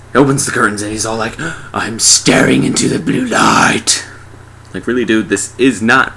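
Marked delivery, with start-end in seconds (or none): 1.7-4.1 slow, hammed-up dramatic voice; entranced victim. He opens the curtains to the light, slow entranced voice. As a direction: draw it out as if mesmerized.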